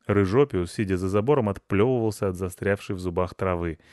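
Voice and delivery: ordinary delivery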